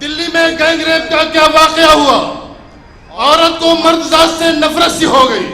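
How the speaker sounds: loud declaiming to the crowd; stately nazm recitation. Acted. (بلند آواز میں نظم خوانی)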